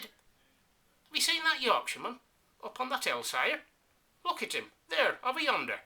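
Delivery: high, loud excited lieutenant; high-pitched eager lieutenant voice pointing uphill. Acted. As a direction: squeal it out like a flustered lieutenant. Lieutenant voiced in comic recitation.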